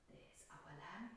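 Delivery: hushed whisper, slow; softly whispered reveal of the finished lamb. Real, not acted. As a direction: breathe it out like a secret reveal. Quiet reveal to children.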